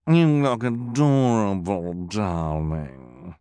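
smooth, fawning diva voice; affected glamorous coo; purring villainess. A narrator's reading. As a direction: purr it sweetly at the child. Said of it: "purred Esme".

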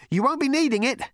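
high, projected call. A narrator's reading. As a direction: shout it back to them. Ford calling over the wreckage.

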